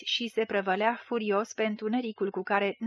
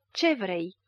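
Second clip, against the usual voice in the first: angry. A narrator's reading. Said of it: "îl întâmpină tot mânios".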